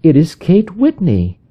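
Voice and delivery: wife recognising her friend, "Kate" falling. A narrator's reading. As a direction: settle on "Kate" with a falling tune. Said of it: "said my wife".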